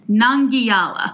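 "Nangiala" slowly, with a hard g and gliding -yala; elegant pronunciation. Shown as a demonstration. Host demonstrating the place name for co-host, elegant slow "Nangiala". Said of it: "hear it in your elegant tone".

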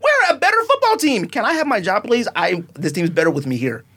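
ordinary talk

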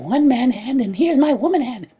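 high squeaky little creature; small fairy voice, very high; tiny creature voice. Acted.

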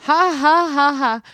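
a loud, high mock cackle of the old lady; mimicked laugh. Retelling the breeder's old lady joke, loud mock laughter. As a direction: laugh it like the joking old woman.

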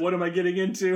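mock alarm. Friend voices the actress's panicked face, alarmed. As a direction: play her sudden worry. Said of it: "voicing Anna Kendrick's alarmed look".

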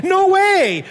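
put-on high loud outraged child cry; child refusing. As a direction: refuse like a scared kid.

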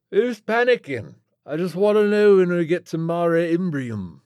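loud, high British rocker protest; indignant washed-up rocker; aging British rock star. A narrator's reading. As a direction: belt it out like an old British rocker. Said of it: "the rapidly aging British rock star".

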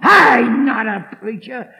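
slow, loud snarled retort. Retold or imitated. Heckler snarls back at the preacher, slow and loud. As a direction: spit it back. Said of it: "And he snarled again".

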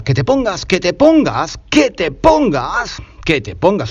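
que te pongas repeated, hammering pongas; stressing the subjunctive. Shown as a demonstration. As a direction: drill the form pongas home.

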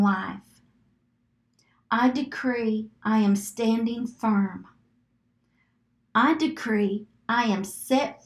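slow, deliberate affirmations with long pauses; measured, spaced-out decrees; slow declarations. Shown as a demonstration. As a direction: leave room to repeat after each one. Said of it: "say it slow enough".

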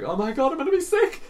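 high-pitched imitation of horrified viewers; mock horrified. Imitating the other viewers watching the film, mock horrified. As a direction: squeal it in exaggerated horror. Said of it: "everybody else is horrified".

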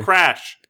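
crash sound effect shouted; loud crash. Shown as a demonstration. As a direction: blare it like a comic sound effect. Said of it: "I don't think a phone sounds like that".

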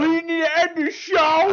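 high, loud hammed-up Tracy Morgan yell; Tracy Morgan impression. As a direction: bellow it as a wild Tracy Morgan. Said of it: "Tracy Morgan's gonna be playing".

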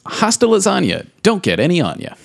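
bouncy, singsong line read; jaunty movie quote with a swinging rhythm. Acted. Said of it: "you read that so jauntily".

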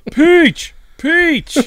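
high, loud cartoon call; cartoon character cry. Friends riffing on Toad's voice, high cartoon shout. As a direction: call out like an excitable game creature. Mimicking a game character's cries.

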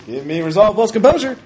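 loud, high-pitched roll call. Real, not acted. Game master repeats the roll call over laughter, loudly.

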